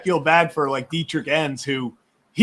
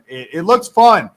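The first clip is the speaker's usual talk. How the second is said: ordinary delivery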